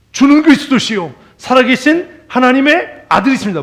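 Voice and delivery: loud, high ecstatic declaration; mock zealous outcry; fervent shout. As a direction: proclaim it like a man beside himself. Preacher acts out fervent confession.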